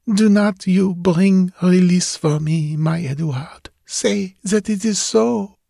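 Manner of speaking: put-on high pleading girl's voice; a yearning ghost girl, lilting; ghostly young woman. Julie pleads with Ned.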